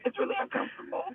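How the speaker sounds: hoarse, almost voiceless; strained, raw-throated words; sore throat voice. Real, not acted. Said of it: "I just sound like I swallowed glass".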